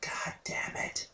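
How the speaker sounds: slow, unvoiced toddler whisper; hushed, eerie little-kid curse; creepy whisper. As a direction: whisper it right into someone's ear. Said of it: "I hear this right next to my head".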